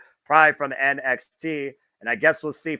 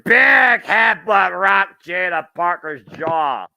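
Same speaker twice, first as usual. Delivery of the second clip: excited call; 5 semitones higher, 8 dB louder, slower than usual. Real, not acted. Big move called live.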